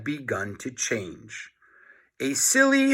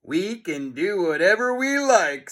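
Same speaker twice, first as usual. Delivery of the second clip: snarling; 5 semitones higher than usual. A narrator's reading. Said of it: "snarled Mister Meaner".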